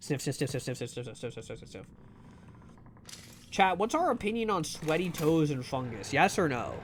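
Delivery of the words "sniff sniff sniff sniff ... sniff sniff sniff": sniffing the air. Pretend. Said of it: "smells strongly of sweaty toes".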